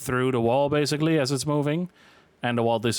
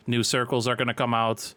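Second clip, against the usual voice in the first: ordinary delivery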